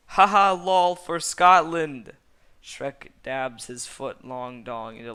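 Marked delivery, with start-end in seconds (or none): 0.1-2.1 huge high roaring yell, slowing on the last words; a mock war cry at full volume; battle cry. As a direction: bellow it like charging into battle.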